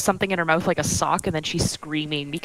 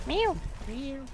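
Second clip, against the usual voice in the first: meow imitation; 9 semitones higher, 9 dB quieter than usual. Meowing at the cat.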